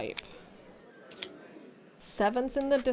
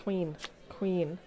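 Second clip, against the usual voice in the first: normal talking voice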